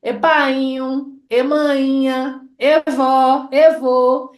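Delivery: "E painho", "E mainha" slow and deliberate; Baixo Sul vocative. Shown as a demonstration. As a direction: stretch each E as a call. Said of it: "essa forma mesmo carregada de fala".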